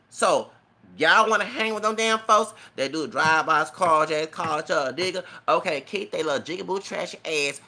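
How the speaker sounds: loud, high-pitched demand; indignant shout. Real, not acted. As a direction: call the crowd out, loud and scornful. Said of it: "I got all emotional".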